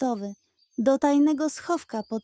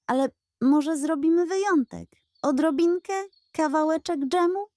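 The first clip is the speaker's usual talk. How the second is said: higher, coaxing, faster at the end; wheedling pug; 6 semitones higher, faster than usual. Acted. Pug coaxing the goat for jam, higher. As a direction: beg sweetly for a little jam.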